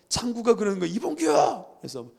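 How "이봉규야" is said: high-pitched acted call. Classmate Changgu calling to friend ahead, high call.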